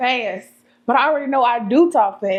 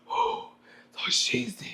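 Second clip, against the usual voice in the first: whispered aside into the pastor's ear, softer; mock whisper; 6 dB quieter, much slower than usual. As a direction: hush it like a secret in his ear. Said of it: "be whispering in his ear like".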